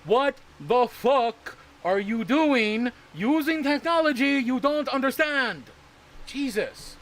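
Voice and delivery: slow, high, drawn-out disbelief; incredulous rant. Real, not acted. Streamer scolding viewers using tech they don't understand, slow incredulous rant. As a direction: stretch every word in exasperation. Ranting at confused buyers.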